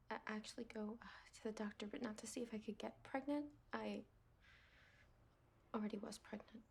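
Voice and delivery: hushed, halting confession; fearful whisper. Acted. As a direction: whisper the secret with dread. Confessing the secret.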